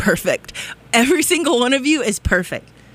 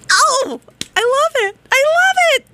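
gushing excitement. Real, not acted. Host gushing about loving the station, squealing. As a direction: squeal with joy.

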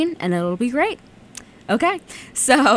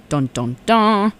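dramatic dun dun dun; 3 semitones higher, 4 dB louder, slower than usual. Pretend. Spooky story ending sting.

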